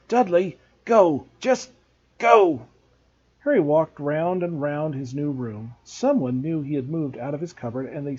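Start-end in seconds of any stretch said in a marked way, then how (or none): 0.1-2.6 slow, breathless barked order, higher and louder; angry shout. A narrator's reading. Vernon orders Dudley after scuffle.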